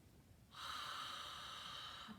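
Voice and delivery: long breathy exhale through a constricted throat, mouth closed; ocean-sounding throat exhale; Ujjayi breath exhale. Shown as a demonstration. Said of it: "it's like this".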